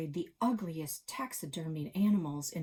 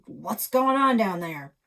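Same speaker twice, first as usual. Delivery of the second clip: louder, slow holler downstairs; 4 semitones higher, 10 dB louder than usual. Retold or imitated. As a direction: call down from the door. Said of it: "Edmund Clark hollered".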